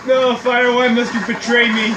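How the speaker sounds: loud, hammed-up cry of betrayal; mock dramatic lament. Gamer yelling at the fire that burned him, loud and hammed-up. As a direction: overplay the betrayal, loudly.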